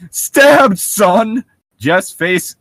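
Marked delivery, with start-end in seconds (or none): 0.1-1.4 high, loud hammed-up shout; louder hammed-up exclamation; mock yell. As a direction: belt it out for laughs. Mocking shouted line.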